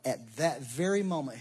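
ordinary talk, nothing marked

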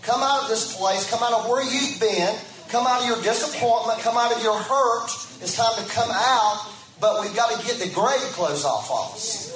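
rising preacher's cry, "Come out"; preaching call. Acted. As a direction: call them out of the tomb with full force. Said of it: "And he's saying".

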